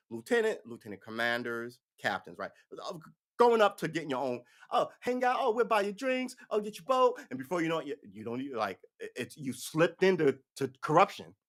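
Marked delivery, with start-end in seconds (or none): 4.7-7.2 high, chummy come-on; mock sweet-talker; schmoozing new friend. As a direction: pitch it up high, coaxing and friendly. Voices agents luring an officer.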